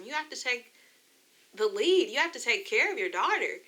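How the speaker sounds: tearful, voice lifting high in places; real crying. Real, not acted. Believer retelling a plea to Yeshua for care, crying. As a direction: plead through real tears. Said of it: "I'm sorry I cried".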